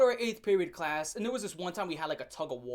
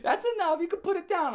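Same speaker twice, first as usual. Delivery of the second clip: mock girlfriend; 9 semitones higher, 4 dB louder than usual. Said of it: "was like".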